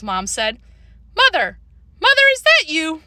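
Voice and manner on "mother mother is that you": high, loud call from another room. A narrator's reading. Libby calls to her mother upstairs, calling out. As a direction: call for a parent, pitched up. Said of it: "Libby called".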